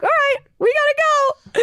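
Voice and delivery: high, hurried urgency; high hurried command; urgent rush. Retold or imitated. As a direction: hustle everyone out. Rushing out.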